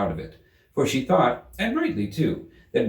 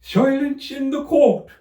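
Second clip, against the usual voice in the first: much higher, louder, slow shrill rabbit cry; 8 dB louder, slower than usual. A narrator's reading. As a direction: call the court to order at full pitch.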